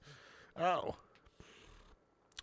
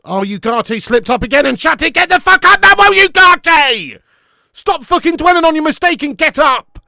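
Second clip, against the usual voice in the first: screaming, much louder and higher; furious shout; 12 semitones higher, 14 dB louder than usual. Real, not acted. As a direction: roar at the player to get up. Furious yelling at player on TV.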